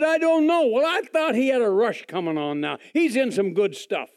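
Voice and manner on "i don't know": higher and louder, a hint of giddy Jimmy; eager friend. Jimmy admitting to Bill he doesn't know, higher and eager. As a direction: blurt it out like a happy friend.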